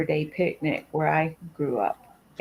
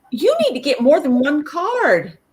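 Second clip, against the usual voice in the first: put-on bossy old lady; 8 semitones higher, 7 dB louder than usual. Old ladies advising at bingo.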